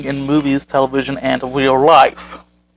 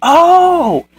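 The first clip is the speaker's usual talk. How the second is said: acted-out light-bulb moment, pitched up; dawning realization; 5 semitones higher than usual. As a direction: read it as a sudden exaggerated epiphany. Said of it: "Then it dawned on me".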